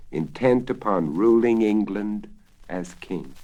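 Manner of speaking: usual speaking voice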